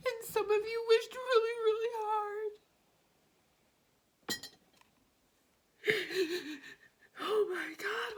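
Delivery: high, loud, voice cracking with tears between laughs; moved to tears while speaking to the viewers; crying. Real, not acted. Tarot reader tells viewers their wish is granted, crying. Overwhelmed by the reading.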